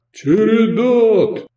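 high, loud, drawn-out call; long hailing shout. A narrator's reading. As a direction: summon the crowd with a long yell. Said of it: "свистнул и прокричал".

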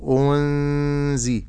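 أنزي very slow, nun hidden in nasal ghunna; correct ikhfa. Shown as a demonstration. Teacher modelling the correct hidden nun. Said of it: "ومقدار الغنة حركتين".

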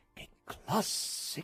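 breathless, awed whisper of delight; hushed rapture; enraptured hush. A narrator's reading. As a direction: gasp it out in wonder. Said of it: "gridavano rapiti".

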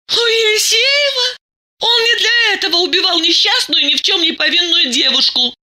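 high-pitched outburst near tears; crying out. A narrator's reading. Masha accuses Diana's husband of murder, crying out. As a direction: hurl it back in a high, outraged cry. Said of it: "закричала Маша".

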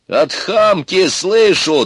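high, loud, drawn-out retort; yelling back. A narrator's reading. Ivan answers Baba Yaga's insult, yelling. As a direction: bellow the comeback. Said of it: "тоже заорал Иван".

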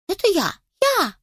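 high excited chick cry; shouting chick. Acted. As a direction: squeal it like a chick. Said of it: "кричал один цыплёнок".

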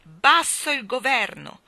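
ominous roar of an angry crowd; menacing crowd shout. Acted. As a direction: chant it as a furious mob. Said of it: "sempre più minacciosa".

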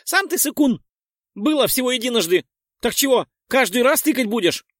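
high squeaky outraged pixie; indignant pixie. Acted. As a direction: squeal it back, offended. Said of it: "От возмущения Пикси ... гневно махнула". (писклявая возмущённая пикси)

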